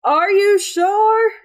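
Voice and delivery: high, drawn-out worried questioning; Carpathius. Acted. Doubting news of a man seen in town, high incredulous character voice. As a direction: pitch it way up and stretch the words in disbelief. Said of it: "Carpathius goes".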